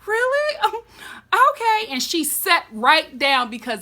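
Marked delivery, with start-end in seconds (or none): 0.0-1.9 higher, caught-off-guard mock Sansa; a stunned, eager little okay; surprised Sansa. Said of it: "She was like".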